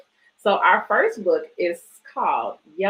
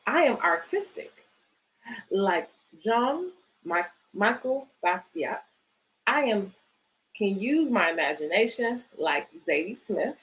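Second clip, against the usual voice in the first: put-on reading to children. Reads picture book aloud.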